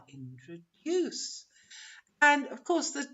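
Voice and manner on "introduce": 'introduce' slowly, in-tro-DUUUCE; third-syllable stress. Shown as a demonstration. Teacher matching the word to da-da-DA, stressed third syllable. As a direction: stretch the final DUCE of 'introduce'. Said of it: "So the third syllable".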